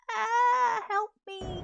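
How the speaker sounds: high, loud, whiny plea for help; computer crying for help. Pretend. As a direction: play the computer begging for rescue. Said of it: "I think my computer's like".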